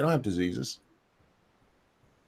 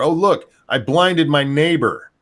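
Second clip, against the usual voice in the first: mocking; 6 semitones higher, 5 dB louder, slower than usual. Mocking an anti-vax parent.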